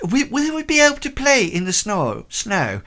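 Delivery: put-on very high, loud eager child's voice; excited little girl. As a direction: beg like a small girl wanting snow. Child Ursula asks to play.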